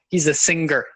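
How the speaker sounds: "singer" said as sing-ger; hard G in singer. Shown as a demonstration. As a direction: hit a hard g before the "er" of "singer". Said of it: "if you do a hard G E R".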